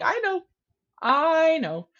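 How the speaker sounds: high, drawn-out "I know"; retold knowing commiseration; emphatic agreement. Client agreeing with her speechless therapist, emphatic.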